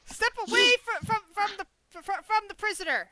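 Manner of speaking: loud, high, stumbling command; shouted order. Acted. Rider orders the group away from the prisoner, shouting. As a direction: call out a warning to back off.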